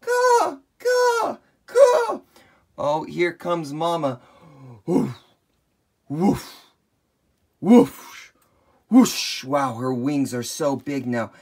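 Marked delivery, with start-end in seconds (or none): 0.0-2.2 very high, loud eagle caw; eagle cry. Acted. Mother eagle calling to her babies, loud high caws. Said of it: "call out for Mama". 4.8-9.4 wing whooshes. Acted. Giant wings flapping.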